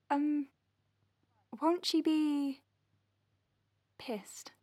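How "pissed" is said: whispered word, no voice. Acted. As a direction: drop to a secret whisper. Nervous about the boss.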